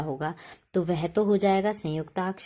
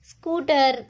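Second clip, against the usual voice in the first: scooter called out loud and high; teacher naming the picture brightly; announced answer; 6 semitones higher, 4 dB louder, slower than usual. Shown as a demonstration. Teacher naming a picture for children, called out. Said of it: "यह है".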